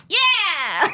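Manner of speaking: high, bright victory whoop; triumphant cheer. Acted. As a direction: cheer over a clumsy win. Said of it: "you hear Girly going like".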